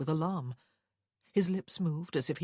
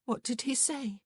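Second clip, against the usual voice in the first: high, weak question; 8 semitones higher than usual. A narrator's reading. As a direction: ask it weakly, pitched high. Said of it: "she asked weakly".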